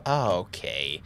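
ordinary delivery

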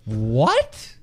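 loud, rising what of disbelief; retold disbelief. Friend hearing about the computer hack, retold loud disbelief. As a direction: burst out, taken aback. Retold shock at the rat news.

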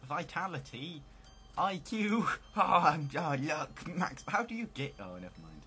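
ordinary delivery

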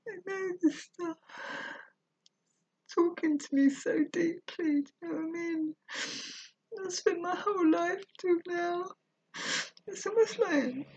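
high, breaking voice full of tears; crying. Real, not acted. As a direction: let the tears take over every word. Overcome, crying while reading.

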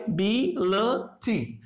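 "बी, ल, टी" as three separate beats; syllable by syllable. Shown as a demonstration. Teacher showing three syllables of ability, "बी, ल, टी" said separately. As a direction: count off "बी, ल, टी" one syllable at a time. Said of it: "three syllable आ गए".

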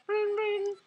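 phone ring in a high voice. Acted. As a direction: sing out the ringing like a phone. Imitating phone ringing.

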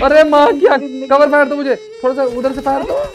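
loud urgent call for cover fire; cover me shout. Real, not acted. Calling teammates for cover fire.

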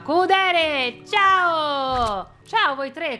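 usual speaking voice

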